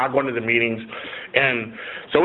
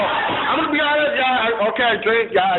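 high-pitched, halting admission; sheepish confession; 10 semitones higher than usual. Retold or imitated. Defendant admits drinking to the judge, sheepish. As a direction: imitate yourself owning up, voice shooting up.

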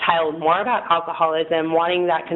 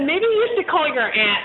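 voicing mom; 9 semitones higher than usual. Mom's suggestion voiced.

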